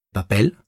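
"papel" stressed on its final syllable, pel; stress on last syllable. Shown as a demonstration. Teacher illustrating the final-syllable rule, "papel" stressed on pel. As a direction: put the weight on the end of "papel". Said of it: "sont accentués sur la dernière syllabe".

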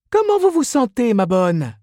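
very high put-on woman's voice; chatty saleswoman. A narrator's reading. Miss Inez greets Mrs Reilly at counter, chatty. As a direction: greet a regular in a high squeal. Saleswoman asks after customer.

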